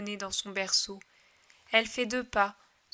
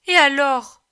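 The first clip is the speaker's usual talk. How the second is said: thrown out loud and sharp; loud challenge; 3 semitones higher, 12 dB louder than usual. Acted. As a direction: dare them to deny it. Triumphant challenge.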